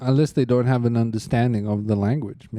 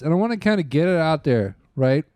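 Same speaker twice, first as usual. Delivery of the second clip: usual speaking voice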